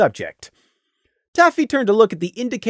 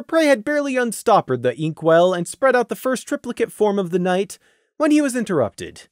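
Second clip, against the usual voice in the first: ordinary delivery, nothing marked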